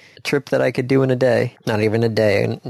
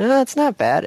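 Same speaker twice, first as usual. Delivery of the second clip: breezy dismissal; 11 semitones higher than usual. Retold or imitated. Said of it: "I'm sitting out here for shopping going".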